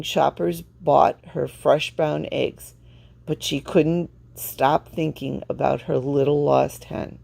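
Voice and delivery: ordinary delivery, nothing marked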